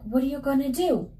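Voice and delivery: high, quick worried question; alarmed question. Acted. Husband alarmed as wife rises.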